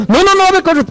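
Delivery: very high, urgent override; insistent interrupting. Acted. Helper shoving the worker aside, high and insistent. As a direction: cut in high and insist on taking over.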